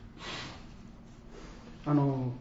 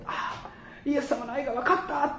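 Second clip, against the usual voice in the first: acted realisation; 10 semitones higher than usual. Acting a believer's moment of realisation.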